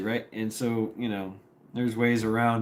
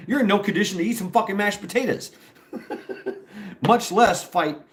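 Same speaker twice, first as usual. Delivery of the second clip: higher, fast, laughing through the taunt; laughing mockery; 7 semitones higher than usual. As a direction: tease him while cracking up. Mocking the hurt drunk.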